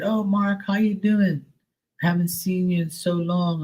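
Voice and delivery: high, sugary mock-friendly mother; overly sweet fake greeting from a neighbor mom; imitating the mom. Mimicking the mom in the car.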